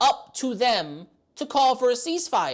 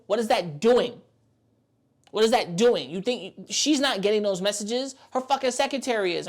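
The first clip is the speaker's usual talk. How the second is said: usual speaking voice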